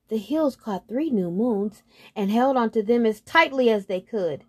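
ordinary delivery, nothing marked

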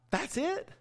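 high, disbelieving question; incredulous. Real, not acted. Preacher reacting to God's verdict on Moses, incredulous and high. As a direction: sound stunned that this is the whole punishment.

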